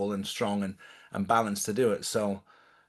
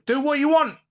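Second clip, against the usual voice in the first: much higher, louder taunt; 6 dB louder than usual. Real, not acted. Daring spies who send curses, high mocking taunt. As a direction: dare them, high and mocking.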